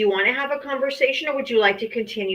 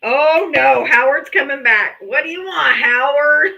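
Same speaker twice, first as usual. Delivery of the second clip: exasperated; 4 semitones higher, 8 dB louder than usual. Real, not acted. Annoyed at caller returning.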